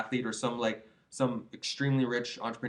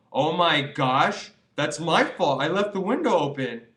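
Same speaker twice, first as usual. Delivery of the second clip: mock dismay; 3 semitones higher, 6 dB louder, slower than usual. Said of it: "you're not gonna go".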